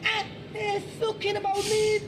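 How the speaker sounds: exaggerated, very high Scouse voice; high Liverpool whoop running into drawn-out words; Scouser accent. Shown as a demonstration. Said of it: "So here's, I think, a Scouser".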